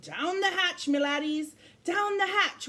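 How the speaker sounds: high, rowdy pirate toast; pirate yell. Acted. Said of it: "pounded his fist on the table and yelled".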